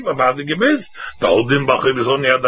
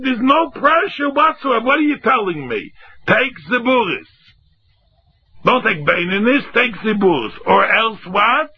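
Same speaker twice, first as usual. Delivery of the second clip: animated retort; 8 semitones higher than usual. Retold or imitated. Debtor retorts, animated scolding.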